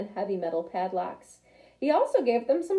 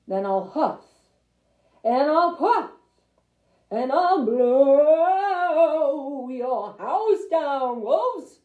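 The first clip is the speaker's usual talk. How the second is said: slow, drawn-out louder threat; big bad pig threatening; 3 semitones higher, 6 dB louder, much slower than usual. Big bad pig threatening to blow the house down, slow and loud. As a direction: stretch out the blow like a bully.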